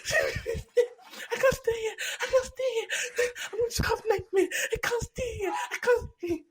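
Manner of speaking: high, laughing imitation of a terrified friend; mock panic. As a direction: act out her frantic panic at the snake, laughing through it. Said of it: "She's like".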